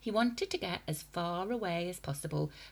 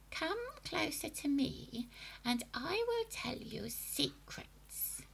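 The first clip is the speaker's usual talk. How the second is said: high, soft, breathy sinister lure; coaxing witch whisper; 10 semitones higher, 5 dB quieter than usual. A narrator's reading. Witch luring boy.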